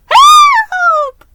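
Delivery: very high, loud yelp of alarm; shrieking as the water knocks him over; panicked high shout; crying for help. A narrator's reading. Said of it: "I cried".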